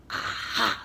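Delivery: low witch aha. Acted. Swamp discovering mask.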